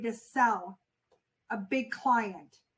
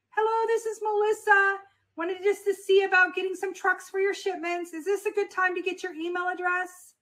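open and friendly; 6 semitones higher than usual. Shown as a demonstration. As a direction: sound like you already know him. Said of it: "how my voice sounded like I know him".

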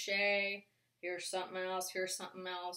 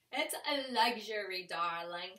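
lightly put-on "darling" socialite voice, a little louder; mock-glamorous repeat, playing it up; hint of a grand diva; playful luxury diva; 4 dB louder than usual.

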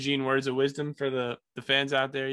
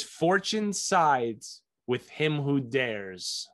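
slow, weighty proclamation; slow solemn reading; much slower than usual. Shown as a demonstration. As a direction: read it like an oracle, slowly. Reading fortune cookie aloud.

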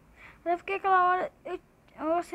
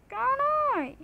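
shocked swear; 7 semitones higher than usual. Real, not acted. Streamer cursing at an impossible kill, shocked swear. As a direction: let it slip out in shock.

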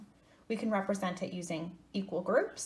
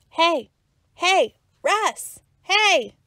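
calling out; 8 semitones higher, 10 dB louder, much slower than usual. Acted. Calling to Russ.

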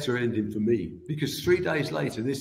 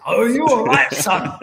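a hint of a Scottish accent, higher and louder; attempted Scottish accent; 9 semitones higher, 9 dB louder than usual. Footballer checking on the boy he hit, attempted Scottish accent. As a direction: have a go at a Scottish accent, knowing you can't. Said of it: "I can't do the accent".

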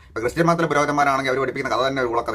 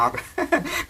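short high burst of laughter; high mocking laugh; 13 semitones higher, 3 dB quieter than usual. Real, not acted. Laughs at comment. (ഉയർന്ന പരിഹാസച്ചിരി)

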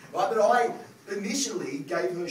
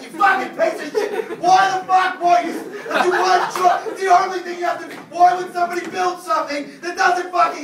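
furious shouting at the toaster, much higher and louder; freaking out; 13 semitones higher, 12 dB louder than usual. Retold or imitated. Husband yelling at a broken toaster, furious shout. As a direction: lose it completely at a toaster. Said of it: "I just fucking freak out".

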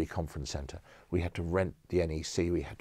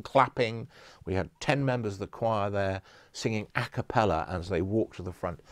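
ordinary talk, nothing marked

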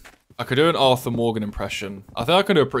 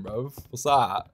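ordinary talk

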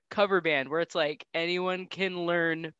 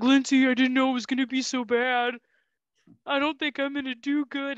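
higher, louder panicky confession; nervous husband; 7 semitones higher, 4 dB louder than usual. Retold or imitated. Stoned husband confessing to Lindsay backstage, higher panicky voice. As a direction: blurt it out panicked before the gig.

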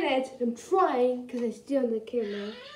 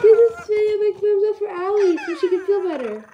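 eager appeal; 6 semitones higher, 7 dB louder than usual. Real, not acted. Asks viewers for thumbs up.

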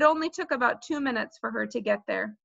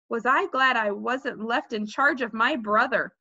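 ordinary delivery, nothing marked

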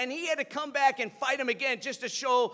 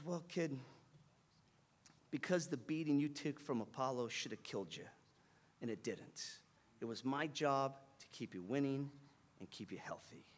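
very low, soft mentor voice; tender confession; 8 semitones lower, 11 dB quieter than usual. Retold or imitated. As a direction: confide quietly in your fighter.

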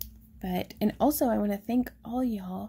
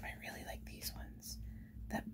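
whisper; 17 dB quieter than usual. Real, not acted. Admiring stones in hushed whisper.

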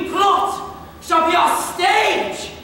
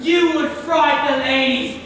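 usual speaking voice

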